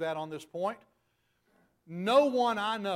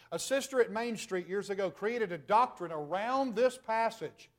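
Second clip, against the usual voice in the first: ordinary talk, nothing marked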